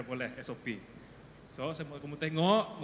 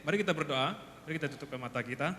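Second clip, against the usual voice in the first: ordinary delivery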